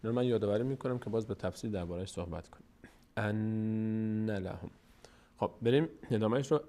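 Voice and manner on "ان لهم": ان لهم chanted slowly with a long hummed n; drawn-out nasal n in ان لهم; ghunnah. Shown as a demonstration. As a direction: hold the ن of ان before لهم.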